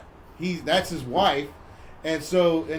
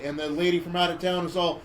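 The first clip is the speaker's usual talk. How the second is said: ordinary talk, nothing marked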